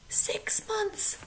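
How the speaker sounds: whispered answer. Real, not acted. Teacher sharing the answer with the class, whispered. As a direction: let the answer out in a hush. Answering her own question.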